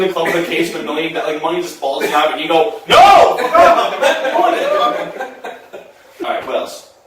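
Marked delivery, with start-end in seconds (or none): 2.9-5.9 big high yelling no, breaking into a laugh; shouted refusal. Retold or imitated. Comic refusing a cash offer, shouting with a laugh. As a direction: yell it like you'd never take the deal.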